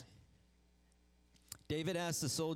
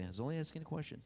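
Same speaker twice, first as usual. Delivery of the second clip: quick call-and-response; 4 semitones higher, much faster than usual. Shown as a demonstration. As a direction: rattle it off to your neighbor. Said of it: "Turn to your neighbor and say".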